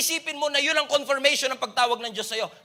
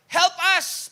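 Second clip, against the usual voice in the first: shouted high and loud, Save us!; a pleading shout for rescue; desperate cry; 8 semitones higher, 5 dB louder than usual. Retold or imitated. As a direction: cry it out like a begging crowd. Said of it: "should be translated as".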